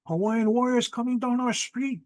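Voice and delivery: high-pitched, alarmed report; acting out a stunned witness; retold alarm. Brother telling his sister what he saw, retold alarm. Brother recounts seeing warriors coming.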